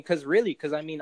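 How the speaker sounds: normal talking voice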